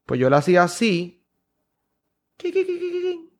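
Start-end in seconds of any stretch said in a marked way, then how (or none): 2.4-3.3 tiny, very high "qui qui qui"; squeaky sound effect. Shown as a demonstration. Magician showing how he would do it, squeaky effect. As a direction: squeak it softly, high above your voice.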